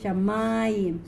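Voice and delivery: "Shamayim" with the accent on the middle syllable; demonstrating middle-syllable stress in "Shamayim"; penultimate stress. Shown as a demonstration. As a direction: stretch the "ma" in "Shamayim". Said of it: "So this is penultimate".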